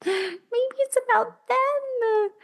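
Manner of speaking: high, slow, gasped wonder; as if struck by a revelation; gasp of realisation. Real, not acted. Sudden realisation.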